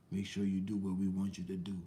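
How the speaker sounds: low, soft menacing voice; a low quiet sinister whisper of a threat; imitated devil threat. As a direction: hiss it like a devil warning. Voicing threatening devil figure.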